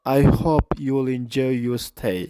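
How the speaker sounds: "I hope you will enjoy your stay" said slowly in full; slow careful full version; full slow form. Shown as a demonstration. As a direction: spell out the full sentence slowly. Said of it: "ኩሉ ግዜ".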